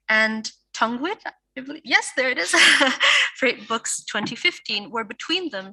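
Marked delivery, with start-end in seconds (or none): 2.6-3.3 short bright burst of laughter. Real, not acted. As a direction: laugh at your own slip. Host laughs mid-introduction.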